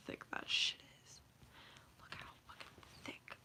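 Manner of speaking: hushed awe at thickness; whispering. Real, not acted. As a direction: whisper it to the viewers. Whispers about thick hat.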